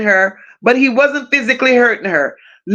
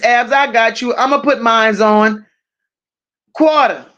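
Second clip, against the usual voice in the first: ordinary talk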